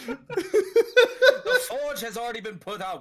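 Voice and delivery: loud, slow, laughing mock-epic line; laughing epic proclamation. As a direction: boom it out while laughing.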